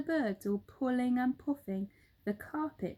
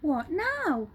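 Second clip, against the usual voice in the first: higher, louder eager question; eager helpers; 7 semitones higher, 5 dB louder than usual. Acted. Helpers turning to Mugglewump, eager question. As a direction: ask it keen. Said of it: "they all said".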